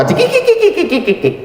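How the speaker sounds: high squeaky tittering giggle; mock giggle. Guests mocking the boy, a high tittering giggle.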